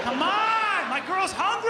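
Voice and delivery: high, loud straining yell; mock furious yell; frustrated shout. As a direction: shout while punching hard.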